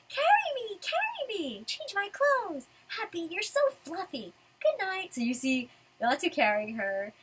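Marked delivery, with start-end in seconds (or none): 0.1-5.1 high, sing-out demanding whine; needy, clingy Lucy. Acted. Lucy telling Natsu to carry her, needy. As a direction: act out being carried and babied. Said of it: "her telling him to take off her clothes".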